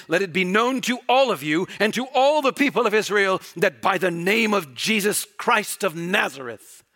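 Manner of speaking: loud, high, impassioned preaching; full-force preaching. Acted. Preacher thundering at the congregation about the court, loud and high. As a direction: drive it home to the congregation, full voice.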